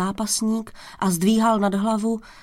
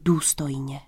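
plain reading voice

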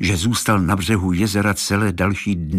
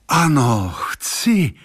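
called out, leaping high in pitch; eager exclamation; 6 semitones higher, much slower than usual. A narrator's reading. Warrior agreeing to become a dove, exclaiming. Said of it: "zvolal válečník".

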